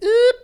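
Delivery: high, loud silly oop; weird noise. Retold or imitated. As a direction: blurt a random squeak. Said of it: "make, like, weird noises".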